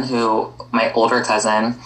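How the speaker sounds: ordinary delivery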